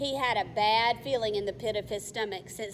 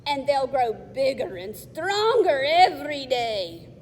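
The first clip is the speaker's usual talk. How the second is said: troll voice; 4 semitones higher, 8 dB louder than usual. Acted. Troll speaks.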